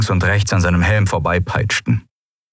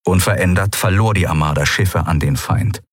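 usual speaking voice

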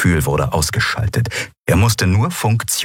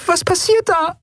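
much higher, squeaky little voice; squeaky peep. Acted. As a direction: peep it out from behind the console. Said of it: "hörte er Nelen hinter der Konsole piepsen".

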